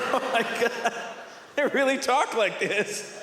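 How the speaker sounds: high, drawn-out amazed words through laughter; laughing in disbelief. Retold or imitated. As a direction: act out cracking up in astonishment. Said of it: "laughing to myself, going".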